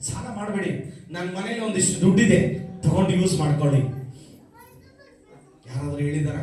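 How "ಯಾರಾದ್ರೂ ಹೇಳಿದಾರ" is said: asked low and hushed, ಯಾರಾದ್ರೂ ಹೇಳಿದಾರ? almost under the breath; quiet. Real, not acted. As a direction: drop the voice right down on ಯಾರಾದ್ರೂ ಹೇಳಿದಾರ?. Preacher repeats question, quiet then loud.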